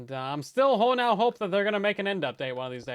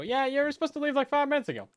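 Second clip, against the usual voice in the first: playful teasing; 11 semitones higher, 4 dB louder than usual. Real, not acted. Teasing friend to leave.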